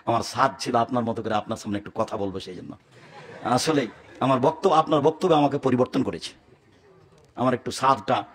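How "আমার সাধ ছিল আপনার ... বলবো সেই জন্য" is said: soft, lowered apology; sheepish apology. Retold or imitated. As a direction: smile it out bashfully. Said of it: "হেসে বলছে".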